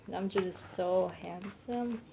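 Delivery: ordinary talk, nothing marked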